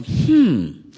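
thoughtful head-scratching hum; puzzled hmm. Acted. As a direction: hum like you're stumped. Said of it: "scratch your head and go".

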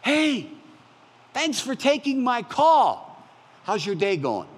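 high, bright-sounding and slow, overly cheerful hello; cheery greeting. Retold or imitated. A caller greets the phone agent with cheery high thanks. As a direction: beam a delighted thanks down the phone. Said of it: "is I go".

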